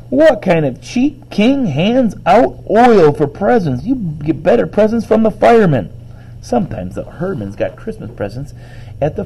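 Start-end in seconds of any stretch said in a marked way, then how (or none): 0.1-5.9 high, loud indignant complaint; hollered. A narrator's reading. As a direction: holler in disgusted disbelief, stretching key words. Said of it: "Imogene hollered".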